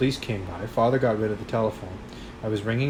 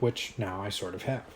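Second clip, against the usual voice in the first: plain reading voice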